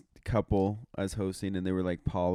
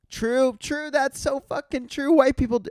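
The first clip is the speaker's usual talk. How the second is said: very high mocking girl voice; mock an over-agreeing girl, pitched way up; slower than usual.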